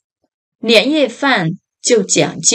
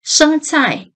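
ordinary delivery

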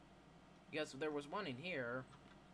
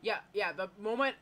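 excited gamer; 4 semitones higher, 10 dB louder than usual. Real, not acted. Excited after dying, found key.

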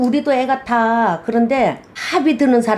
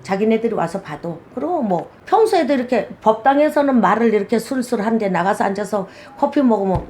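ordinary talk

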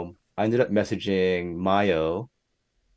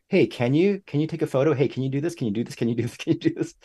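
higher-pitched, rapid-fire string of asking; pestering requests; 6 semitones higher, faster than usual. Retold or imitated. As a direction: pester thirty people, one request tumbling after another. Re-enacts pestering people with requests.

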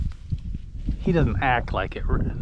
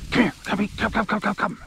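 calling the dog; 8 semitones higher, faster than usual. Real, not acted. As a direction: call the dog over.

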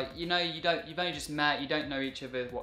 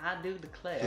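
put-on Georgia accent; 3 dB quieter than usual. British vlogger showing an American accent he likes, Georgia accent. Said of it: "the Georgia accent from America".

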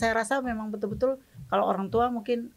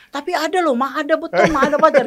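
louder, higher eager insisting; insistent child; 5 semitones higher, 9 dB louder than usual. Retold or imitated. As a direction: push the claim like a kid who won't back down. Younger child insists loudly. (adik ngotot dengan suara tinggi dan keras)

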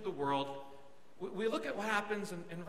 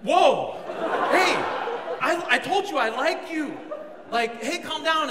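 louder, higher, taken-aback protest; mock alarmed calming; 7 semitones higher, 8 dB louder than usual. Preacher retelling his reply to her weeping, comically alarmed. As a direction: try to halt the crying, startled.

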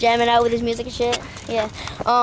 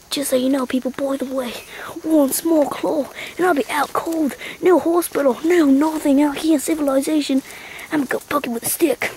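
hushed mock Aussie wildlife commentary; Australian survival host; 2 semitones higher than usual. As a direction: confide the danger to viewers like a bush guide. Australian host warns viewers of danger.

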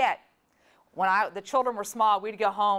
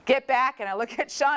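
ordinary talk, nothing marked

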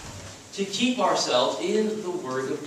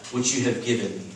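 measured, lower solemn Bible reading; scripture reading; 6 semitones lower than usual. Acted. Pastor reading Jesus' prayer to the chapel, solemn scripture reading. Reads John 17 aloud.

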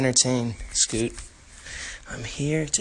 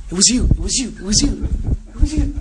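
accusing shout; 8 semitones higher, 9 dB louder, faster than usual. Acted.

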